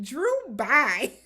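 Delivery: laughing it off, loud; laughing dismissal. Real, not acted. Host laughing off the scammer, laughing. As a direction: bye through a laugh.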